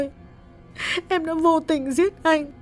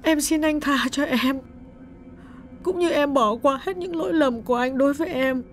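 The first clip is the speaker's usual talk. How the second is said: much higher, soft choked-up woman; choked with tears; 3 dB quieter than usual. A narrator's reading. Widow forgives her dead husband, choked with tears. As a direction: speak through a tight throat. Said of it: "nghẹn ngào bảo".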